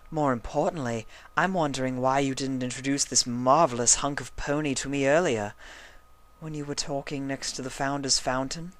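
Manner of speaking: coy, teasing pony voice; lightly voiced Rarity. A narrator's reading. Rarity flirting over the stallion, lightly high.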